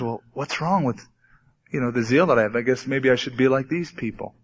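softly voiced, hesitant self-doubt; lightly acted, let the volume drop as if deflated; discouraged young believer. Poor new believer wondering to himself, quietly discouraged.

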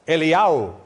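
Eliyahu said the Hebrew way, el-ee-YAH-oo; Hebrew pronunciation. Shown as a demonstration. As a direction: say "Eliyahu" as in Hebrew, not English. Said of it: "The Hebrew pronunciation of Elijah is".

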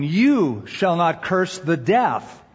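slow reading, hit "You" hard; stress on You. Shown as a demonstration. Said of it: "I'm gonna emphasize the word".

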